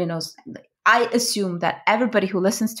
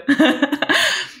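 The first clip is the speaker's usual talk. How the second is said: laughter; 10 semitones higher, 4 dB louder than usual. Real, not acted. Laughing at the joke.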